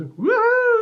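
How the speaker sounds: high, loud whoop; gleeful whoop. Retold or imitated. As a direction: let out a wild prankster whoop. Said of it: "he goes".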